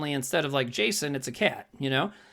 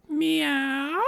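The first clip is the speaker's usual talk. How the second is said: higher mock meow; a quick playful meow; imitated cat meow; 7 semitones higher than usual. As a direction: do the cat's meow as a joke. Retelling the sound she hears.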